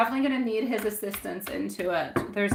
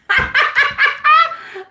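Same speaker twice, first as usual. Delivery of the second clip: high, loud burst of laughter; 11 dB louder than usual. Real, not acted. Psychic laughing at her own typing mix-up. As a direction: crack up at her own mistake.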